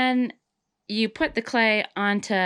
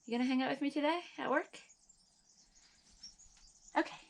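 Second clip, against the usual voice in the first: higher and softer, talking to a pet; 6 semitones higher, 8 dB quieter, faster than usual. Real, not acted. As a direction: coo gently at a little animal. Talks softly to a pet.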